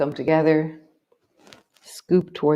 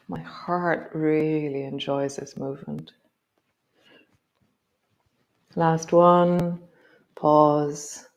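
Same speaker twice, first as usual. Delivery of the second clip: guided movement cue. Acted. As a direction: guide the body gently through each move. Leads a guided movement practice.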